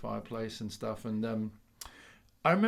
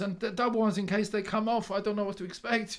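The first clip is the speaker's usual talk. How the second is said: put-on Polish accent; 6 semitones higher than usual. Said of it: "I keep doing the Polish accent".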